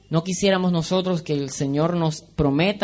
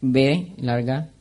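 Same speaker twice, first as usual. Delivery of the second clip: normal talking voice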